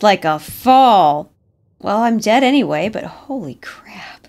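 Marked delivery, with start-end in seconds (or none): 2.9-4.3 breathless whisper of shock; unvoiced scared whisper. Real, not acted. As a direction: hush it, scared to be heard. Creeping through horror game.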